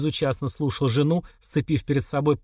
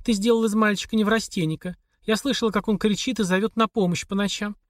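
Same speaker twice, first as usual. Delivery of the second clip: a hint of a higher, pleading wife's voice; lightly voiced wife Olga; 7 semitones higher than usual. A narrator's reading. Wife demanding a divorce from her husband, lightly voiced. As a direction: read her lines a little higher, worn out and insistent. Olga's voice in the dialogue.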